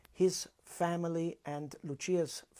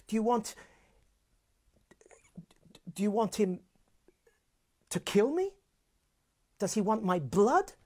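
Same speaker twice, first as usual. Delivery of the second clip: higher and louder, wounded indignation; offended outburst; 6 semitones higher, 5 dB louder than usual. Acted. Edgardo rages at Lucia about her brother, higher and louder. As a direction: hurl the accusations at her like an insulted lover.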